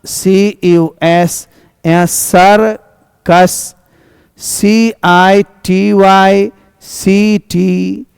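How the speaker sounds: letter by letter, very slow; spelling aloud slowly. Shown as a demonstration. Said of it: "हम लोग देख रहे हैं ना".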